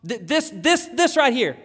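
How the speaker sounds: loud, high, tongue-tied stammer; excited stutter, too overwhelmed to speak. Acted. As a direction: trip over the first word, overcome. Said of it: "to stutter".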